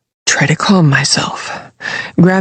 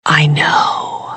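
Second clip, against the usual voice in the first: secretive voiceless whisper; furtive whisper; 4 dB quieter than usual. A narrator's reading. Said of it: "in a furtive whisper".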